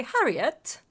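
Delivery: Harriet in a mock accent, open first vowel like "Hah"; hammy accented Harriet; put-on accent. Shown as a demonstration. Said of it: "an accent".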